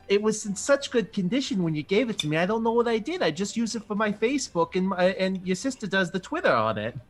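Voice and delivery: a cheerful, clueless Minnesotan mother; Minnesotan mom. Acted. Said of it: "she gives a small chuckle and she says".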